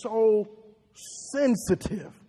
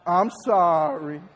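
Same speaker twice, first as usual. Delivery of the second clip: slow, drawn-out I'm sorry; slow practice apology; 4 semitones lower, slower than usual. Shown as a demonstration. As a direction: say I'm sorry slowly with the crowd. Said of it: "Everybody say it with me".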